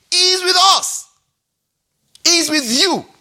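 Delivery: fervent preacher's cry; emphatic declaration. Real, not acted. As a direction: hammer the promise home.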